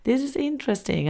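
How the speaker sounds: high, hushed realisation; wondering aloud. Retold or imitated. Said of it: "I was like".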